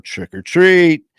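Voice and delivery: loud, slow, high sing-song call; drawn-out trick-or-treat chant; kids calling trick or treat. Retold or imitated.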